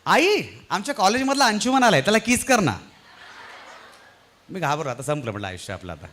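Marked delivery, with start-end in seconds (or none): 0.1-2.8 higher and louder call to her mother. Retold or imitated. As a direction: shout it toward the other room. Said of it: "ती पटकन ओरडली". (आईला मोठ्याने हाक मारणारी मुलगी)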